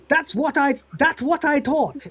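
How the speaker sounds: put-on high, caricatured Taiwanese accent; Taiwan Tony.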